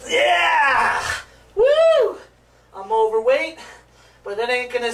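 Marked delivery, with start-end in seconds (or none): 0.1-2.2 high, loud victory shout; triumphant whoop. Real, not acted. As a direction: crow after landing the trick. Celebrating a handstand.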